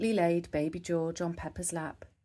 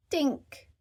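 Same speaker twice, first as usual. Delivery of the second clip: put-on high little-girl word; high piglet voice; toddler baby talk; 9 semitones higher than usual. A narrator's reading. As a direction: offer it like a tiny child. Peppa offering cup.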